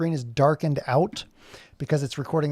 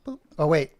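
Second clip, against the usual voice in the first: normal talking voice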